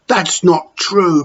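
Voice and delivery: put-on slow, firm child protest; boy protesting. Tom defending the porter to Matron, slow protest. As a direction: insist it isn't so. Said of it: "protested Tom".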